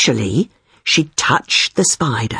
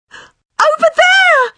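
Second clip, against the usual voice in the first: very high, drawn-out shriek; terrified scream; 4 dB louder, slower than usual. A narrator's reading. Friend pointing at something on the floor, screaming. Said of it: "she screamed".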